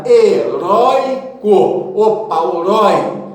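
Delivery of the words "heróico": heróico stretched out syllable by syllable; slow syllabified word. Shown as a demonstration. Teacher contrasting with herói, slow careful syllables. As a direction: pronounce heróico slowly for the class.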